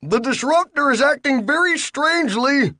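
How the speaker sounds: very high frightened cry; panicked Bill. Acted. Bill panicking to the captain, very high. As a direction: squeal it in panic.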